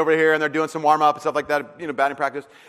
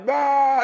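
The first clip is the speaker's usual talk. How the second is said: parents gasp; 9 semitones higher than usual. Retold or imitated.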